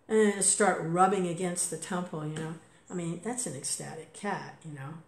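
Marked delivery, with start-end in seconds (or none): none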